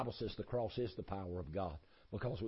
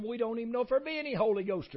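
pitched way up and louder, bewildered; high incredulous voice; 13 semitones higher, 9 dB louder than usual. Retold or imitated. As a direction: answer as baffled new believers. Disciples answering Paul.

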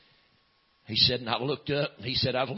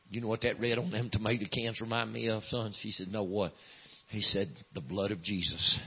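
soft, low retold exchange; quiet, lowered voice before the big moment; hushed storytelling; 4 semitones lower, 6 dB quieter than usual. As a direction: drop low and let it land gently.

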